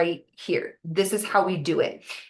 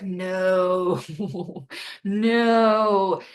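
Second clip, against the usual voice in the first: mock dismayed no. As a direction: act out the dread of a forgotten lunch with a laugh. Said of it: "all of us are like".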